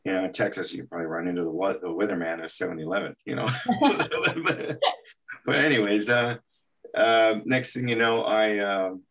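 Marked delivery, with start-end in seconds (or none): 3.5-4.4 high-pitched chuckle at own joke; amused laugh. Real, not acted. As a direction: laugh lightly at your own aside.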